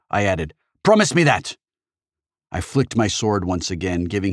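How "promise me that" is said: loud, high insistent order; forceful shouted demand. A narrator's reading. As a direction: force a promise from your foe. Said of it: "I demanded".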